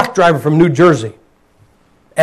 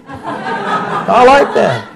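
laughing; 2 semitones higher, 3 dB quieter, much slower than usual. Real, not acted. Joke about seeing America.

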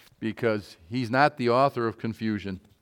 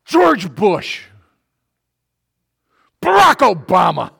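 shouted names, much louder and higher; angry yell; 7 semitones higher, 14 dB louder than usual. Retold or imitated. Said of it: "do they yell out".

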